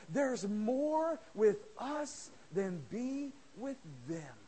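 drawn out, each word weighed; slow emphatic. Acted. Prophet reassures his servant, slow and weighty.

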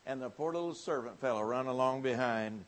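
ordinary delivery

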